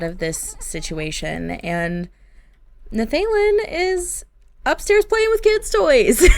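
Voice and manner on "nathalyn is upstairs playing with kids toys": laughing. Real, not acted. Laughs at unbothered husband.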